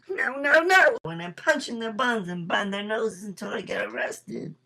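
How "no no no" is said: rising hammed-up scream, much higher and louder; mock panic. Thief tackled by two cops, screaming panic. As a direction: protest wildly as the cops pile on.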